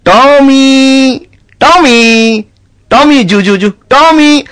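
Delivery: high, drawn-out call; calling the dog. Acted. Nawab calling his dog Tommy, high and drawn out. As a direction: call the dog across the yard.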